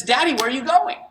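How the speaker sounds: put-on high, small toddler voice asking daddy; little girl voice. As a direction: play the two-year-old pleading to her father.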